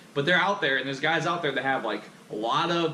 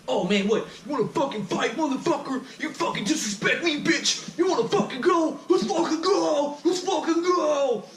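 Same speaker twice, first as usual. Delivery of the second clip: much higher, hammed-up challenge to fight; mock a hothead biker squaring up, pitched way up; aggressive biker taunt; 12 semitones higher than usual. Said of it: "he goes up, he's like".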